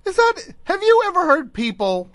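high, squeaky laughing disbelief; words squeezed out through laughter. Real, not acted. Host laughing at a guest's escape-route story, high-pitched.

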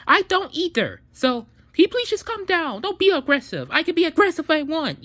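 loud and high, the last line fast; bickering couple raising voices; heated argument. Acted. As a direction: snap back hard at the partner. Quarrel heats up.

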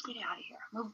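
hushed whisper to dad. Real, not acted. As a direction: whisper it aside.

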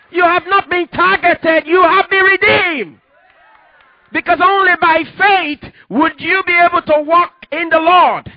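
high, loud climactic preaching; preaching at full force. Acted. Pastor driving the message home to the congregation, fervent preaching. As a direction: lift the congregation, building to a shouted hallelujah.

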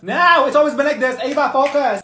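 high, loud, fed-up retort; exasperated friend; impatient snap. Acted. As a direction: cut her off and make her focus. Impatient friend snaps back.

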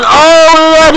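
thrilled ringside call; excited outburst. Real, not acted. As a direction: let the hype jump up. Commentator reacting to swing.

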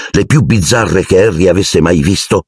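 ordinary delivery, nothing marked

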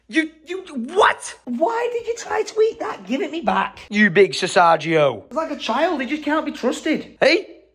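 high-pitched telling-off of a naughty pet; high scolding voice. Real, not acted. Scolding the robot like a child.

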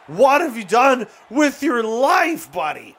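high, slow, loud exasperated shout; exasperated yell. Real, not acted. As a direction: yell it at a useless teammate, drawn out. Frustrated yelling at teammate.

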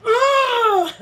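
sharp high yelp of pain from a sudden snap. Retold or imitated. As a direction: yelp as the laser snaps you. Laser snap during small talk.

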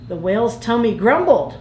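usual speaking voice

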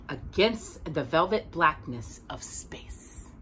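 hushed, awed whisper; whispered starry line; storyteller whisper. A narrator's reading. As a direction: whisper it like a secret of the sky. Hushed image in story reading.

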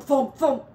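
rising banging thumps. A narrator's reading. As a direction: pound the words like a fist on the wall. Noise from wall narrated.

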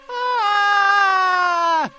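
long, very high thin scream; high sustained squirrel shriek. A narrator's reading. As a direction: scream in terror, held long. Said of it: "she screamed".

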